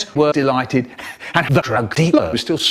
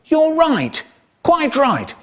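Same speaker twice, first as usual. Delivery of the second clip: much higher, emphatic; prim posh approval; pompous high voice; 12 semitones higher, slower than usual. Acted. Pompous agreement repeated.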